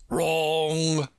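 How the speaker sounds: Humpty Dumpty catching Alice out, triumphant; triumphant exclaim. A narrator's reading. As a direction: crow it like you won. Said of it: "exclaimed triumphantly".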